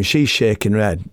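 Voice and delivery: plain reading voice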